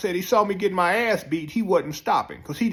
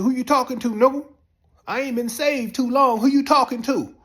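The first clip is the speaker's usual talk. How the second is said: loud, higher, menacing challenge; threatening; 9 semitones higher, 5 dB louder than usual. Retold or imitated. Pastor confronting the man cursing him, loud threat. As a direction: demand it with a gun in hand.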